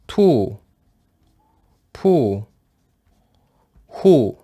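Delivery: each u-syllable said clearly on its own; syllable drill. Shown as a demonstration. Teacher drilling Japanese u-syllables for students. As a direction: call the syllables out for the class. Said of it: "On écrit comme ça".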